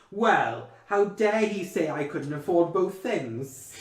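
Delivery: high, loud, slow posh indignation; snooty lady. Retold or imitated. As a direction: play the offended rich lady, nose in the air. Snooty shopper quoted in scene.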